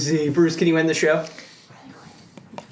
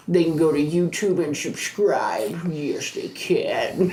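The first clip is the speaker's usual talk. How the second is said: put-on drawn-out, softer babying voice; 6 dB quieter, slower than usual. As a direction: coo it slowly as if to a pet. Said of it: "That's so weird. Like when Michael Scott talks in his baby voice".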